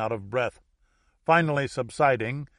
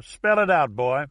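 put-on coarse Louisiana accent, louder at first; coarse Louisiana American; 4 semitones higher, 7 dB louder than usual. A narrator's reading. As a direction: order him like a Louisiana good old boy. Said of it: "coarse American from Louisiana".

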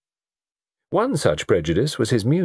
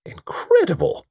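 high, drawn-out exclamation; theatrical disbelief; astonished cry; 10 semitones higher than usual. A narrator's reading. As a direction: cry out in amazement, stretching the word. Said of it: "cried Harry Dalehouse".

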